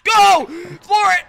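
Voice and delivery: high, loud burst; urgent shout. Real, not acted. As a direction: explode into a getaway order. Sudden getaway from attackers.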